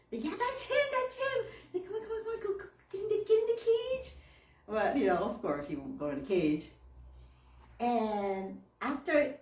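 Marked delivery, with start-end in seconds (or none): 0.2-4.1 excited coaxing. Retold or imitated. Friend excitedly coaxing bird.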